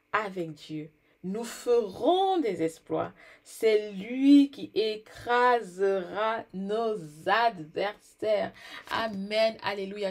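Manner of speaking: slow, loud drawn-out proclamation; preaching. Real, not acted. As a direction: preach it at full force, stretching words. Preaching climax.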